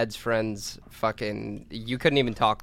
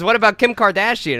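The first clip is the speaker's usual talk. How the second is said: raised, eager question; eager suggestion; 7 semitones higher, 5 dB louder than usual. Retold or imitated. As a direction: pitch it brightly. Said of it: "and he goes".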